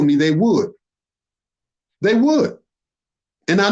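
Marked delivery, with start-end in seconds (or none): 2.0-2.6 pitched higher, rising, insistent; emphatic high repeat. Real, not acted. Warning his enemies, a higher insistent echo. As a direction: throw it up high in disbelief.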